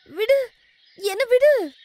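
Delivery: very high, loud cry to be released; alarmed shout. Acted. Young woman to milkman gripping her hand, alarmed shout. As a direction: shout in alarm, pulling free.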